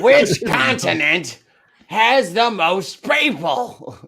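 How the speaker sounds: high and slow, screechy mock game show host; Gottfried-style host. Friends mocking Gottfried hosting, asking a trivia question high and slow. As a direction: stretch the question out high like a shrill comic.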